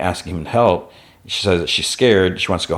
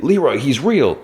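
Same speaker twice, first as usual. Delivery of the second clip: high-pitched urgent call, retold; urgent warning; 7 semitones higher than usual. Paulie tries to stop Leroy firing, mock urgent call. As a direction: plead to get through, pitch swooping up.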